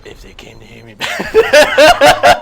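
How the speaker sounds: loud, strained voice at half speed; Master Chief. Acted. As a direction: push out a slow, forceful mock-hero impression. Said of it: "Here's Master Chief".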